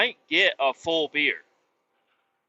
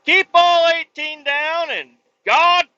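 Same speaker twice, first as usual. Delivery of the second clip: patriotic sign-off; 14 semitones higher, 8 dB louder, slower than usual. Pretend. Patriotic podcast sign-off shout.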